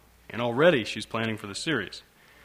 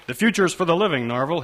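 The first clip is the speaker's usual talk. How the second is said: lecturing father; 5 semitones higher, 5 dB louder than usual. Acted.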